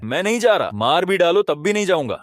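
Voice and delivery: high-pitched, indignant protest; acted, vehement objection; stubborn refusal. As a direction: dig your heels in against the order. Hero refuses master's order.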